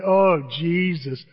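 slow, drawn-out exclamation; imitated groaning brother. Brother reacting to talk of Jesus, drawn-out groan. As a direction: stretch out a mock groan. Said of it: "That's what he says to me".

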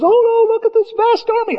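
very high-pitched alarmed cry; mock panic. Servant seeing the army at the door, hammed-up panic. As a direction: squeal it like a panicking sidekick.

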